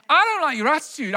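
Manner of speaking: quick, high-pitched fairy telling-off; scolding good fairy. Acted. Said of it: "That's how I said it".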